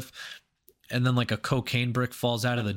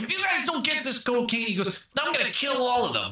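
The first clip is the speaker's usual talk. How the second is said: high hammed-up threat; squeaky cartoon villain; mock gangster threat; 10 semitones higher than usual. Retelling the movie, Ray Liotta's high mock threat.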